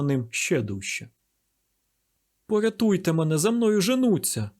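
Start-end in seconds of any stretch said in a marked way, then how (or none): 2.5-4.5 high-pitched desperate plea; acted imploring cry, pitch raised; pleading exclamation. A narrator's reading. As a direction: beg to be saved from pursuers. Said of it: "вигукнув благально Андреа".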